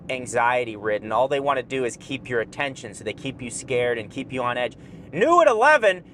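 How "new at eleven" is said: higher, louder news teaser; mock news anchor. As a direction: hype it like a late-night promo.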